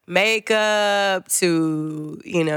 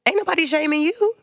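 indignant retort; 11 semitones higher than usual. Retold or imitated. Retort to the boss.

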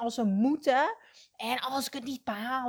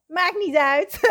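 laughing; 7 semitones higher, 7 dB louder than usual. Real, not acted. Laughing about sitting in her bathrobe.

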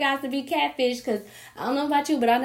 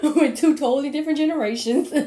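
laughing through the words, louder and higher; can barely get it out for laughing; laughing hard; 5 semitones higher, 7 dB louder than usual. Real, not acted. Laughing as she tells viewers about the date.

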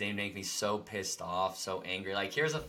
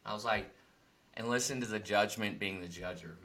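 ordinary talk

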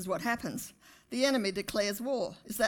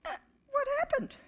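a much higher, fast, weepy squeak; mock whimper; 5 dB quieter, faster than usual. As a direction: play the bewildered victim, half crying. Mimicking a bewildered believer.

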